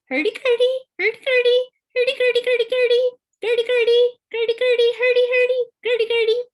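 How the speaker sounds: very high, loud, fast whistled bird call; cardinal call imitation. Shown as a demonstration. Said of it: "I could mimic the distinctive".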